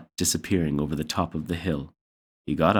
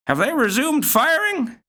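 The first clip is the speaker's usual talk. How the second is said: put-on high old grandmother voice.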